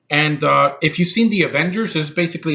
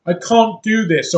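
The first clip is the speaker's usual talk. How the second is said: slow, raised mock British accent; 7 semitones higher, 4 dB louder than usual. Reviewer mocking non-American actors' accents, mock British accent. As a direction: exaggerate a bad British accent. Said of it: "They don't have shitty accents like".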